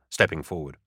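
plain reading voice

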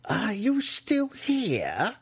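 high, drawn-out shriek of outrage; imperious high-pitched screech. Acted. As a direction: shriek each word slowly at an intruder. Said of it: "Malice shrieked at him".

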